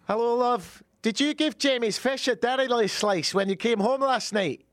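high-pitched put-on accent, questioning; sketch nagging partner. Partner asking about the fish, high accented sketch voice.

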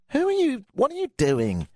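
asking what on earth he is; exasperated. Real, not acted. Host rants at Kim Jong-un, exasperated high-pitched disbelief.

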